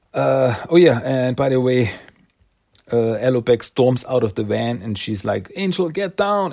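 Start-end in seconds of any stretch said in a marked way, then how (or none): 5.6-6.5 high urgent call to duck; yelled warning. Retold or imitated. Warning a teammate before explosion.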